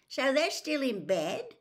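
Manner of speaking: grumpy. A narrator's reading. Fred complains at breakfast, mildly grumpy. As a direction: grumble it half-awake. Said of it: "said Fred grumpily".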